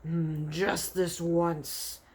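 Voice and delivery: very slow, drawn-out brute; greedy giant giving in. Acted. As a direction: savour the temptation, then give in. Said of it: "the blood bottler said".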